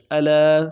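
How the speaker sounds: الا with a hard hamza, said loudly; wrong hamza. Shown as a demonstration. A Quran teacher warns students off the hamza mistake, with a loud wrong reading. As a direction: sound الا with a glottal catch, the wrong way. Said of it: "حمزے کے ساتھ".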